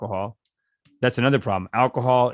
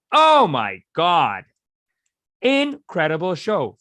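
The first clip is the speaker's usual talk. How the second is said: excited; 6 semitones higher, 5 dB louder, much slower than usual. Real, not acted. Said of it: "raving about comedy show".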